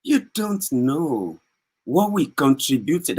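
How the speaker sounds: slow, drawn-out mimicry of a self-important politician; lightly voiced pompous leader, slow; mocking the leader's speech. Mocking the politician to online viewers, slow drawn-out mimic. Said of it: "You see the way he's talking there?".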